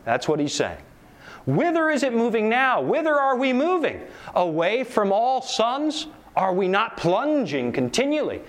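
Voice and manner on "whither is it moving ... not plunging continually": higher, rising lament of questions; dramatic reading. Acted. Preacher reading the madman's lament, rising despair.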